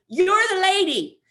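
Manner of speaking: put-on high shouted child's accusation; mock six-year-old, very loud and shrieky; Rainbow. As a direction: yell it like an insistent little kid pointing someone out. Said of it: "Rainbow then is caught up in telling me".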